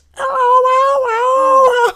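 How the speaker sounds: loud, very high yowling wail; screaming cat imitation. Mocking singers in a video clip, screaming-cat yowl. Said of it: "they sound like screaming cats".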